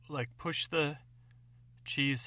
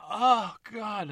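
louder, much higher gagging cry; disgusted groan; 9 semitones higher, 10 dB louder than usual. Acted. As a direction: reel from a horrible stink. Hit by the cheese smell.

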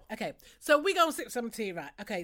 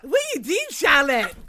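mock Geordie accent; 3 semitones higher, 8 dB louder than usual. Host mock-scolding a reality star, loud Geordie accent. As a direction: cry out in exaggerated Geordie.

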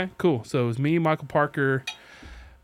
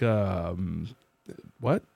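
confused; 5 semitones lower than usual. Retold or imitated. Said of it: "his reaction was golden".

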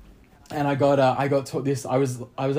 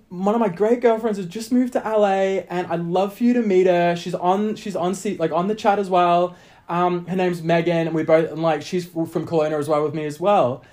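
higher-pitched imitation of a friendly woman, easing down; friend's chatty message; 5 semitones higher than usual. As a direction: lightly voice a friend writing to set up a meeting.

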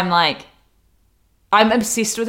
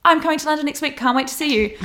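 high, bright excited greeting; gushing greeting; 8 semitones higher, faster than usual. Retold or imitated. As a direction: gush over her like an old friend. Richie greets her warmly.

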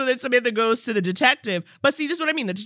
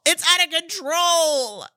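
excited repeat; 9 semitones higher than usual. Shown as a demonstration.

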